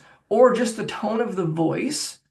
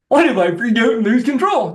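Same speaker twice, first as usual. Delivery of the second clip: high, loud squawky cartoon voice; a badly done Daffy Duck squawk; 5 semitones higher, 7 dB louder than usual. Shown as a demonstration. Coach demos the fear thought as a high, loud cartoon voice. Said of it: "that was a terrible one".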